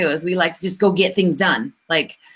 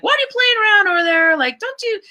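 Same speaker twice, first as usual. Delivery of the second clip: fast, high mock-parent nagging; scolding parent; 13 semitones higher, faster than usual. Parent scolding kids at play, fast high mock nag. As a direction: nag the kid in a quick high voice, half-joking. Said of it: "She's like".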